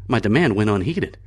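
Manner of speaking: ordinary delivery, nothing marked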